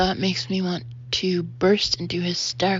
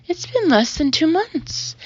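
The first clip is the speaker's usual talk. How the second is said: higher, louder exasperated protest; disbelieving protest; 9 semitones higher, 5 dB louder than usual. Acted. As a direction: protest that it can't be.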